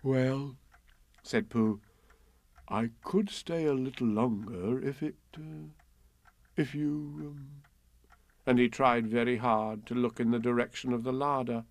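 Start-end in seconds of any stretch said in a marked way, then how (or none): 0.0-7.6 hesitant Pooh bear. Acted.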